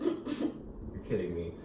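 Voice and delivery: slow, low, soft "you're kidding me"; disbelieving mutter. Retold or imitated. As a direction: roll your eyes under your breath. Thinking while called back.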